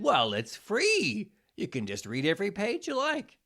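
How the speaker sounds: upbeat scripted advert voice; promotional ad read. Acted. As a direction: sell the book to the listeners. Reads promotional outro for book.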